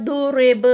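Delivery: ضرب with heavy ض and the ب bouncing at the stop; thick dad, then echo the ba of ضرب; qalqala on ba. Shown as a demonstration. Teacher demonstrating qalqala on ضرب. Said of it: "تو با کا قلقلہ".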